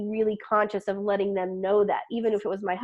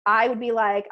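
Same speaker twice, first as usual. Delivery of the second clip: normal talking voice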